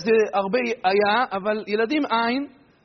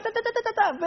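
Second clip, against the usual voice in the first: high mock shouting babble, softer than it claims; mock yelling babble; 10 semitones higher, 4 dB quieter, faster than usual. Friend rattling at the couple, mock yelling babble. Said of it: "והוא צועק".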